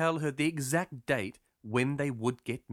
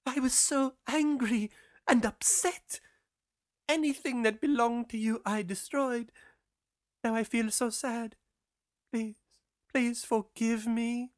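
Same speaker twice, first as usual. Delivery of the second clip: put-on remorseful girl; 9 semitones higher than usual. A narrator's reading. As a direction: beg forgiveness in a high girl's voice.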